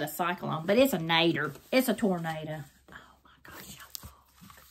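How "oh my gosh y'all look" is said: whispered amazement; hushed, speechless awe; awed whisper. Real, not acted. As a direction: whisper it, lost in wonder. Awed by the book's pictures.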